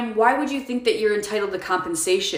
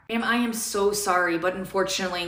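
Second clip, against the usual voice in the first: ordinary talk, nothing marked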